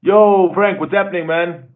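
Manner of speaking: high, loud shout-out; calling out a hello to a viewer in the live chat; excited welcome. Real, not acted. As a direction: greet him like a mate arriving.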